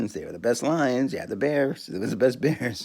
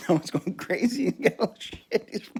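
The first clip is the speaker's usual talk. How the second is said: laughing; 9 semitones higher than usual. Real, not acted. Laughing at his own joke.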